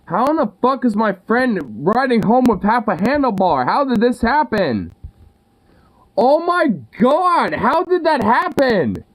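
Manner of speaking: incredulous, pitch climbing high; can't believe what you're seeing; disbelief. Real, not acted. Finds friend's broken handlebar.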